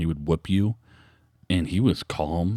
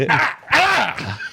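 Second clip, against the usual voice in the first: pained cries. Retold or imitated. Retold pain on waking.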